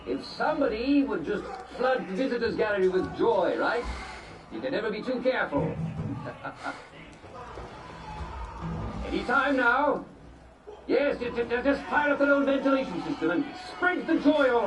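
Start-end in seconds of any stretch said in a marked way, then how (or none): 5.7-9.0 long giggling laugh, trailing away; drawn-out chuckle. Acted. Host amused by his hint about joy, long chuckle. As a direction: chuckle on and on at your own hint.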